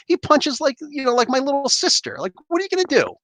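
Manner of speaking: high-pitched, scornful ridicule; mocking. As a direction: taunt a feeble opponent in a high voice. Mocking the attacker's weak punches.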